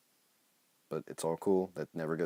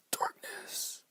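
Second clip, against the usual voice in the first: mock dramatic whisper; 3 dB quieter than usual. Dramatic reading of story.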